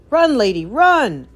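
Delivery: loud, high call to flee; urgent loud warning. A narrator's reading. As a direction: call out to her to run. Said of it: "called Tramp".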